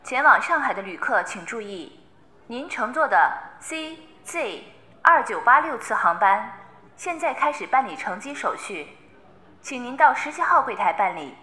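loud formal PA announcement; airport announcement. Acted. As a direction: read it like a terminal speaker. (机场广播)

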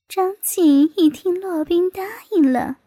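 ordinary delivery

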